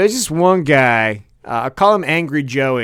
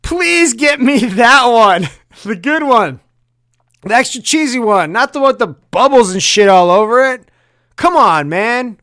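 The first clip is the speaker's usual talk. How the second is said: whiny, high, loud plea; pleading customer; 8 semitones higher, 5 dB louder than usual. Retold or imitated. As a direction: beg for the cheesy slice. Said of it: "you're like".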